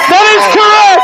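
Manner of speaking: loud, high game-show call; shouted verdict. Real, not acted. Host cheers the comic's answer with a loud verdict. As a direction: announce it like a quiz host.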